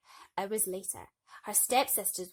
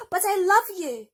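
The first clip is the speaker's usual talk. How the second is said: high, louder desperate plea; pleading; 11 semitones higher, 14 dB louder than usual. Acted. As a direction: beg her to stay. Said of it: "he pleaded".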